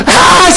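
loud, high mock laugh; imitated laugh. Passenger reacts to pastor's joke, loud imitated laughter. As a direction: burst out in a hammed-up high laugh. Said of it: "she was laughing".

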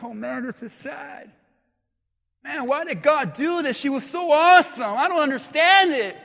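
hammed-up whine, pitch climbing; plaintive, high imitation of grief; mock tearful lament. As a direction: act out a crying mourner's complaint. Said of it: "the disciples are crying too".

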